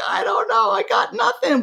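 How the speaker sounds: the mind throwing up its hands; the helpless mind. Acted. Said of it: "and the mind's like".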